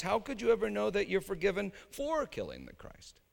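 ordinary delivery

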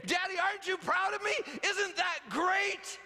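put-on high, excited child's voice that stretches out the last line; proud toddler voice. Son seeking his dad's approval, high and excited. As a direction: beam like a toddler showing off. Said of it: "as if to say".

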